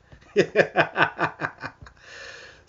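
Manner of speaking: high, loud rapid cackle; loud laugh. Real, not acted. Host amused by his own woohoo, loud laugh. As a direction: burst into a long run of high laughter.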